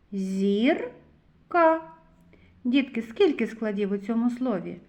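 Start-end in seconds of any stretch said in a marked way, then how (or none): 0.1-1.8 зірка read as zir, long pause, ka; split into syllables. Shown as a demonstration. Teacher reading with children, зірка in separated syllables. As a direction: break зірка into two slow syllables. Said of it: "Прочитаємо".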